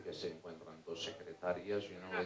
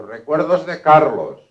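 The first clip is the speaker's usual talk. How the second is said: loud, slow, higher-pitched greeting; acted booming hello; hearty greeting; 5 semitones higher, 15 dB louder, slower than usual. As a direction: deliver the regards in a big, drawn-out voice. Same greeting repeated insistently.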